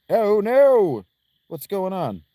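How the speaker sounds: high alarmed shout; alarmed cry. Acted. Voicing character alarm.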